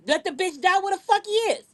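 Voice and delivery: ordinary talk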